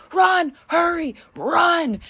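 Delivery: high, loud, frantic cry to run; urgent yelled warning. Retold or imitated. Woman in doorway urging the girl to run, high frantic yell. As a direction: call her in fast, high and loud. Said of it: "she's going".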